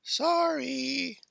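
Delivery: high, small-voiced apology; sheepish kid. A narrator's reading. Kid apologising for the stray shot, high and sheepish.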